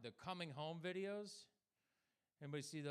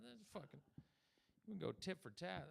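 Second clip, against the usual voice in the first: usual speaking voice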